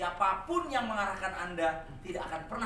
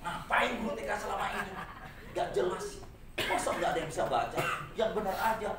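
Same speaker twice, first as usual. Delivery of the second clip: grumbling, scoffing teacher voice; complaining teacher; 5 semitones higher than usual. Retold or imitated. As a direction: gripe like an annoyed teacher. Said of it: "mengumpat guru T K-nya". (guru menggerutu kesal)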